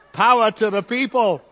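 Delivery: loud, high rallying call; rallying shout. Pretend. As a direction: fire up the crowd like a protest chant. Rallying the congregation to respond.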